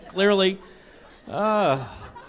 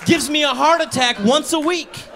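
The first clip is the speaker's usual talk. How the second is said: emphatic correction; 6 semitones higher than usual. Retold or imitated. Mom correcting loudly.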